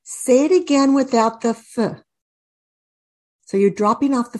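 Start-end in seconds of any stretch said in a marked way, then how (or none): 1.5-2.0 "th" said as a lone f sound, "fuh"; isolated letter sound. Shown as a demonstration. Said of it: "You wanna say the sound".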